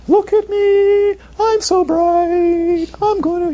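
high, loud mock boast; boastful pants. Teacher voices bright pants to students, high mock boast. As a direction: squeal like showy pants. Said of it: "Do pants usually speak and go".

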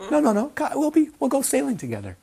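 high-pitched playful coaxing; coaxing teacher. Acted. Acted coaxing of a reluctant student.

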